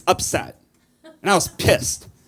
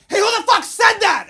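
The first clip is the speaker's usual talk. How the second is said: much higher, louder, challenging; indignant shout; 14 semitones higher, 4 dB louder than usual. Retold or imitated. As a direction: yell it back at the room. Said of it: "the blind girl went".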